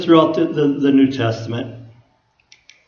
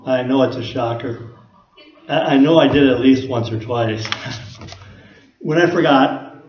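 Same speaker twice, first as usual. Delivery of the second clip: ordinary delivery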